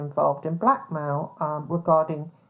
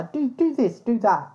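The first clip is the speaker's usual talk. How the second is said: mimicking bossy editors; 5 semitones higher than usual. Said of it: "ask things like this".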